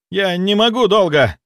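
high straining cry; strained shout. A narrator's reading. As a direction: cry out at the limit of strength. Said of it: "крикнул парень".

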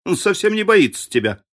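high-pitched amusement; amused, laughing remark. A narrator's reading. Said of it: "рассмеялся Генри".

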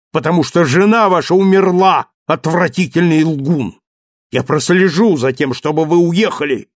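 high, loud, scornful rant; furious tirade. Acted. Marius throwing Thenardier out with money, furious tirade. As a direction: pour out contempt without pause.

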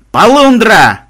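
high, very loud alarm cry; yelling. A narrator's reading. Makmed drawing his bow, yelled alarm. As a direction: holler a warning. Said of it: "заорал Макмед".

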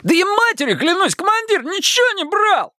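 very high, squeaky pleading oath; squeaked protest. A narrator's reading. Detainee swearing innocence to the officer, high squeak. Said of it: "пискнул ещё Романов".

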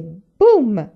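loud, punchy storybook boom; loud narrated impact; booming crash. A narrator's reading. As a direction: hit it like a sudden crash.

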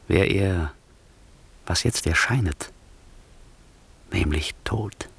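voiceless conspiratorial whisper. Acted. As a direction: hiss it softly, dead secret. Antonio whispers murder plot. (verschwörerisch geflüstert)

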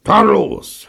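loud, high exclamation; hearty call. A narrator's reading. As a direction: call it out with gusto. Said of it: "rief er". (laut und hoch gerufen)

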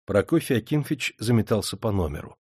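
plain reading voice

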